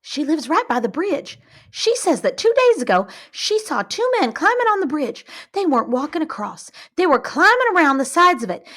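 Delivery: high, urgent gossipy telling; lively eyewitness woman; excited woman's voice. Retold or imitated. Retells a woman's account.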